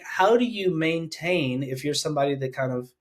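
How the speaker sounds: ordinary talk, nothing marked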